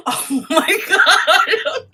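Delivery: laughing. Real, not acted. Host still laughing at the guest's praise, slow loud laughter. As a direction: drag it out while still laughing.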